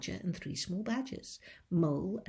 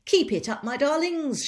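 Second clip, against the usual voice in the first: loud, higher cheerful shout; shouting encouragement; 9 semitones higher, 11 dB louder than usual. Acted. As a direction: cheer them on as you run. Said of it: "shouted Mister Fox".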